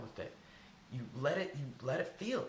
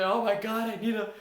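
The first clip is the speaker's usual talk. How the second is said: much higher and louder, a hammed-up desperate gush; mock frantic cry for a hug; over-the-top plea; 11 semitones higher, 9 dB louder than usual. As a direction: ham up begging a coworker for a bear hug. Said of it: "go up to your coworker and say".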